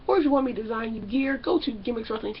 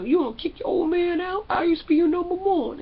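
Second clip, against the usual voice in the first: mock hurt dad; 6 semitones higher than usual. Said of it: "Trinity dad saying".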